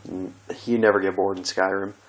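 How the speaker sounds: usual speaking voice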